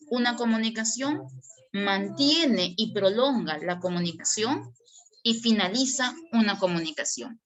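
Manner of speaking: normal talking voice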